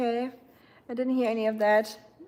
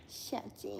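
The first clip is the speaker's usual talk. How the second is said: very soft, whispery pet name; hushed little call; cooing to a pet; 13 dB quieter than usual. Real, not acted. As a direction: whisper it softly to a sleepy pet.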